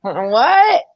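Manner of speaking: high, loud confused what; imitating deaf uncle. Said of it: "he'd be like".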